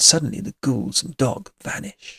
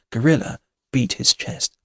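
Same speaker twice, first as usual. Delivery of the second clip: ordinary delivery, nothing marked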